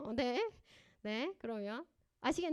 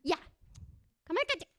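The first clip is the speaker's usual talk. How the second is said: put-on much higher and louder angry snap at the child; scolding yell; 7 semitones higher, 4 dB louder, much faster than usual. Shown as a demonstration. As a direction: yell at the kid to hush, the wrong way. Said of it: "이렇게 할까가?". (아이에게 버럭 소리치는 엄마)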